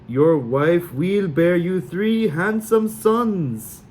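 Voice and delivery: very slow, high, hammed-up Sully accent; drawn-out mock Sully prophecy; fake Sully accent. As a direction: improvise a grand Sully fortune. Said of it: "the most outrageously Sully accent".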